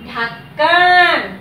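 slow modelled word; slow drawn-out answer. Shown as a demonstration. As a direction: draw ढक्कन out so children can copy it. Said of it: "क्या बना?".

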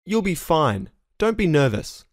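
ordinary talk

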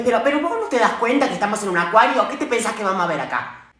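fast, loud exasperated rant; fed up, rattle it off loudly. Acted. Exasperated mother.

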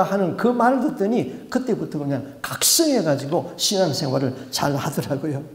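normal talking voice

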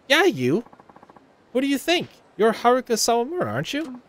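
high, bossy call to stop her; snooty idol barking a challenge; haughty rival idol. Acted. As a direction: stop the girl like you own the street. Voicing rival Ulala.

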